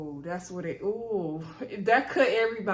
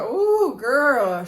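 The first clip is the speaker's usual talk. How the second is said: shocked sympathy; 3 semitones higher, 5 dB louder than usual. Retold or imitated. Host's retold shocked reaction.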